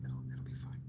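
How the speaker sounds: whisper, no voice; whispered to self. Real, not acted. Talking himself through changing, whispered. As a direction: mutter it under breath.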